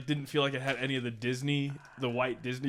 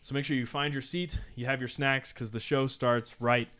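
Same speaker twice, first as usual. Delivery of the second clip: podcast host intro; 5 dB louder than usual. Acted.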